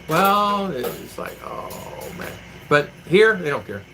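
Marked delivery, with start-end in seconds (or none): 1.4-2.3 hushed, sighing mock groan; weary dread. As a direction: let it out as a tired sigh, barely voiced. Same weary reaction, retold.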